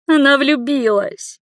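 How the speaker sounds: high, drawn-out wail of grief; lamenting mother; mournful wail. A narrator's reading. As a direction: wail it slowly, high and woeful. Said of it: "ещё горестнее завыла мать".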